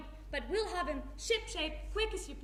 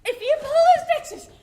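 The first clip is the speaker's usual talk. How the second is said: much louder, higher cry of alarm; 10 semitones higher, 17 dB louder than usual. Acted. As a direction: shout the warning in panic. Mother alarmed for son.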